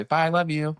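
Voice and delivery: put-on toddler voice. Toddler echo from back seat.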